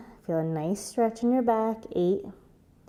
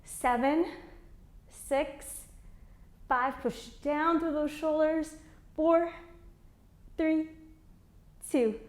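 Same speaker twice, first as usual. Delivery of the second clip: shaking voice; 6 semitones higher than usual. Real, not acted. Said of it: "you could hear it in my voice, I'm shaking".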